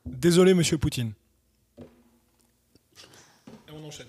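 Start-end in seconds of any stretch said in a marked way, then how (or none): 1.8-4.1 breathy whispered aside. Real, not acted. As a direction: whisper it under your breath. Aside dodging a risky question.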